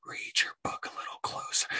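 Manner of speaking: hushed whisper, all breath; a pointed whispered jab; whispered retort. Retold or imitated. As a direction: lean in and whisper the advice. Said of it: "I just wanna tell 'em".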